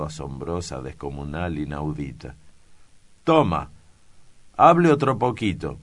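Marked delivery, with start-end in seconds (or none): 3.2-5.8 high, loud jeering challenge; loud taunt. Acted. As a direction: dare him to keep talking. Priest taunting the clerk.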